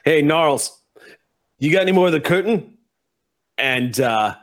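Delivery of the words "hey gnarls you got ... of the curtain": higher, louder call, a bit quick; calling to the goblin. Acted. Red Cap driver calls back to the goblin, higher and louder. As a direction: shout back to Gnarls. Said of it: "he goes".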